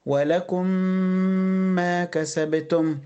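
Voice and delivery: slow, "ولكم ما" as one long nasal meem; fuse the meems, held two beats; idgham demo. Shown as a demonstration. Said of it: "ميم واحدة".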